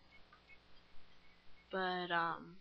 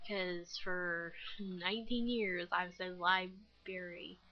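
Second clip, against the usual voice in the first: ordinary talk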